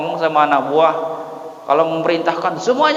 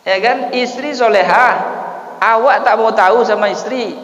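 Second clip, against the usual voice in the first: fiery preaching; 6 semitones higher than usual. Acted. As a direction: preach hard to the congregation. Opening of the sermon.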